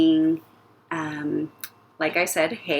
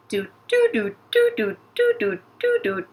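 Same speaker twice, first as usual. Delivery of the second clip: walking sound effect. Pretend. Voicing the character's walking steps.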